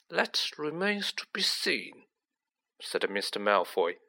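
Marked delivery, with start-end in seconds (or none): 0.1-2.1 higher, slow and hushed; soft, slow villainous insinuation; nasty smile. A narrator's reading. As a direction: say it silkily through a nasty smile. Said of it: "with a nasty smile".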